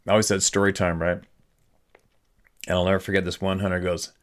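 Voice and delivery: ordinary talk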